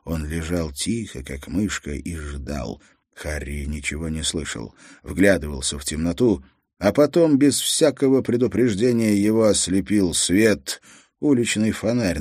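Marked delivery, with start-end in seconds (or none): 0.1-6.4 soft, low, suspenseful narration; hushed. A narrator's reading. Narrator on Harry lying hidden and waiting, hushed. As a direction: drop the voice and hold still. Hero lies silent in the dark.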